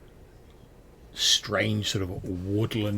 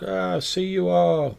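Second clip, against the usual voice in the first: guard; 10 semitones higher, 6 dB louder, slower than usual. Acted. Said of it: "And like".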